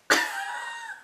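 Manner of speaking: very high-pitched burst of laughter; high squealing laugh. Real, not acted. Vlogger cracking up before revealing her message, squealing laugh.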